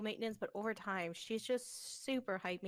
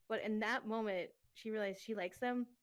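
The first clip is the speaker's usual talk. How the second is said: usual speaking voice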